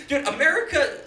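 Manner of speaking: ordinary talk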